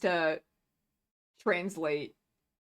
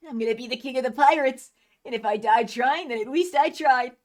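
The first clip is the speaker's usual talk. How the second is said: voicing manga characters; 8 semitones higher, 7 dB louder than usual. Reads manga aloud voicing characters.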